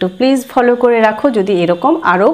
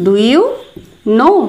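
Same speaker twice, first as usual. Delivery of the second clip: spaced words ending in a rise; teacher dictation; slow demo; much slower than usual. Shown as a demonstration. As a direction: say it slowly for learners. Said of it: "এটার জন্য বলবো".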